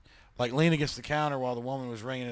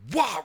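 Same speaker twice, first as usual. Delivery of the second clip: high, loud "wah" impact noise; loud imitated smack; 11 semitones higher, 7 dB louder than usual. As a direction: yell out a crash sound. Imitating the falling rafter's impact.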